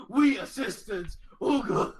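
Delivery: mock a dim brute's broken speech; caveman voice. GM mocking the party's message in caveman voice. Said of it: "She just says".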